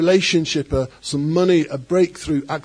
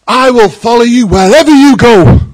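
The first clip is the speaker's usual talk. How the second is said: much louder, higher fervent cry; on fire vow; 7 semitones higher, 13 dB louder than usual. Retold or imitated. Follower fresh from charismatic worship shouting to Jesus, fervent. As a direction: proclaim it on fire from God. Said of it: "they're on fire from God".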